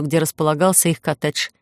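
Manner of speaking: usual speaking voice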